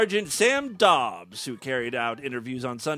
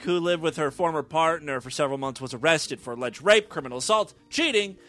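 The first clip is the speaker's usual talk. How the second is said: ordinary delivery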